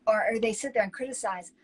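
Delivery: usual speaking voice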